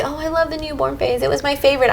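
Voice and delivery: mocking gushing moms. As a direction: parody other moms raving sweetly. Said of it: "So when I hear moms say".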